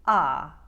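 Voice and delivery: "ah" fully open as in spa; loud, low, wide Queens "ah". Shown as a demonstration. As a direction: open "ah" wide, Long Island style. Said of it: "will make it the wider".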